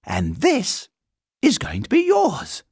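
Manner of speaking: rising to high, loud reveal; excited girl. A narrator's reading. Girl revealing the bear's own room, excited. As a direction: build to a high-pitched flourish.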